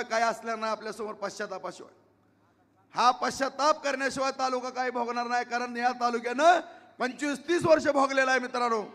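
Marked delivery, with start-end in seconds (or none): 5.4-8.8 loud, raised climax; rally shout. Real, not acted. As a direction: build to a shout for the crowd.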